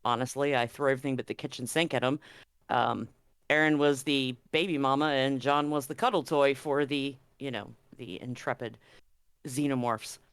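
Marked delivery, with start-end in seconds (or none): none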